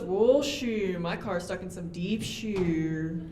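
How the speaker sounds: slow imitated Outer Banks drawl; mock local drawl. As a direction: lay on the coastal accent, slowly. Truck guy stuck in sand.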